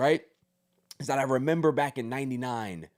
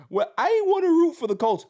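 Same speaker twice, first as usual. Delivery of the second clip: high, fast mock whine of a sulking kid; whiny kid; 12 semitones higher, 5 dB louder, faster than usual. Mocking a kid fan who won't root for the Colts, whiny. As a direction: mimic a pouting boy, pitched up and quick.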